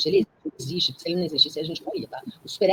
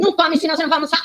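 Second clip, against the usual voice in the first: high, fast mock mother's warning; mother scolding; 10 semitones higher, 5 dB louder, much faster than usual. Mother warns child grabbing a snack, high and fast. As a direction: scold the hungry child like a fussy mom. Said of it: "E a mãe fala assim".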